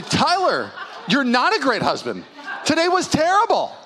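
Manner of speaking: lightly acted telling-off, rising in pitch; scolding wife. Wife correcting her husband after the outing, reproachfully.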